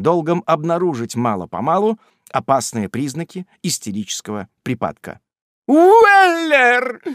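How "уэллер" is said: piercing high shriek of a name. A narrator's reading. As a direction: shriek his name across the room. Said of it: "закричала достопочтенная леди".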